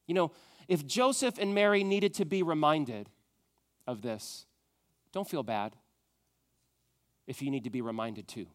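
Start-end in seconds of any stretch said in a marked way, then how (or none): none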